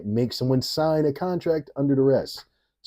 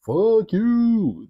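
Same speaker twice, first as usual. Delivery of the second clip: mock heckler shout; 12 semitones higher, 5 dB louder than usual. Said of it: "it just goes".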